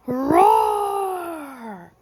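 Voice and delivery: loud, high hammed-up storybook roar; bear roar. The bear wakes up and roars at the party. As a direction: let out a big comic bear roar.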